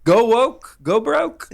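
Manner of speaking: mock commentator punchline; pundit slogan. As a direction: deliver it like a smug catchphrase. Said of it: "Do you know the expression?".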